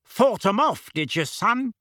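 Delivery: high, hearty, blustering question; loud. A narrator's reading. Uncle turns to his son about the fight, loud. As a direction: pitch it up and out at the son. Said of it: "said Uncle Vernon loudly".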